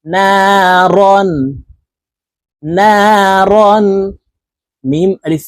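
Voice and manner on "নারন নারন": নারন drawn out slowly, vowels held; slow recitation. Shown as a demonstration. Teacher chanting নারন for the class. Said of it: "র আলিফ দুই জবর রন".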